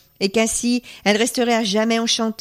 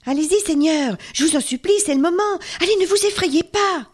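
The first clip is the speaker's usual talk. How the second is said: de plus en plus aigu, de plus en plus suppliant; supplication pressante; 9 semitones higher than usual. Acted. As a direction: pousser les chevaliers, voix montante. La tzigane presse les chevaliers. (la tzigane supplie les chevaliers d'avancer, montée aiguë)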